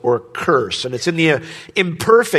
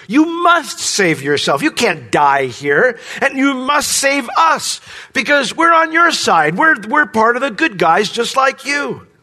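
upset demand; 9 semitones higher than usual. Retold or imitated. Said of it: "He's upset with Jesus".

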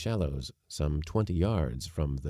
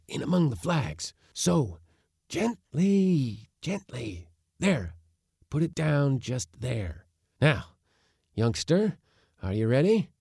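put-on high, eager old man orders; commanding old uncle; 8 semitones higher, 3 dB louder than usual. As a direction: hurry the crew, careful but keen. Uncle directing the crew.

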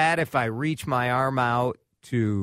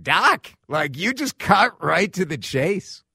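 mock exasperation; 6 semitones higher, 4 dB louder than usual. Mock retort to the doctor.

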